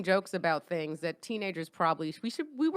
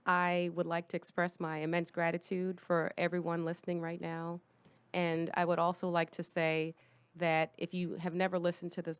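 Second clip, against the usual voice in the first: serious voice; 3 semitones lower, 7 dB quieter than usual. Acted. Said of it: "due to the tone of my voice".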